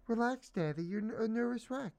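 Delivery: high, teasing daughter calming her dad; a girl reassuring her nervous father; teen daughter voice. Acted.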